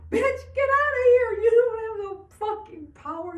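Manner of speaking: high, very loud yell; explosive angry command; furious banishing shout. Acted. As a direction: shout the devil out. Yelling at the devil.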